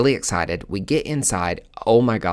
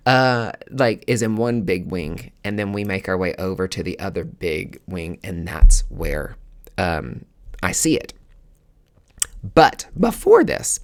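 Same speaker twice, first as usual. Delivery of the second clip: ordinary talk, nothing marked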